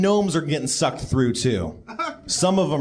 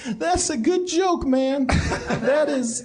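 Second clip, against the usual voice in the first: much higher voice, cracking up; laughing through words; 10 semitones higher, slower than usual. Acted. Game master as guard praising the player's joke, laughing. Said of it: "he's rolling on the floor laughing. He's like".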